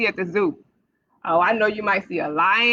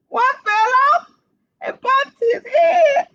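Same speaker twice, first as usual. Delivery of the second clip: much higher, loud hammed-up crying shout; mock alarm; 5 dB louder, slower than usual. Librarian reading to kids, pretend crying over the fallen bunny. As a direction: cry out the fall like a pretend catastrophe.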